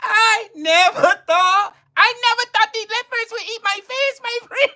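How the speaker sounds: high-pitched put-on Mexican accent; mock a wail in a Mexican accent; hammed-up Mexican lament. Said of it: "talk in my Mexican voice".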